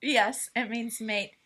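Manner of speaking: usual speaking voice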